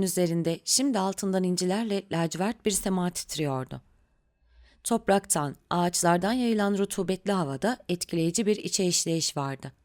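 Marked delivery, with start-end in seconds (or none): none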